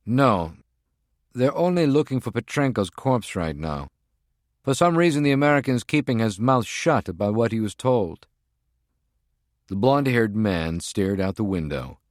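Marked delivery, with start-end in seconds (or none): 0.1-8.3 higher, brisk henchman's voice; a tense field agent reporting in; agent's voice. Acted. Voices Prague One on phone.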